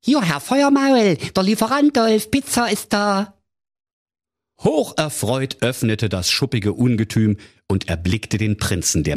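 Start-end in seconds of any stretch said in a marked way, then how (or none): 0.0-3.4 very high, chirpy put-on delivery-boy voice; disguised delivery voice. A narrator's reading. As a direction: sing it out like a cheery pizza man at the intercom. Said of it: "da rief er".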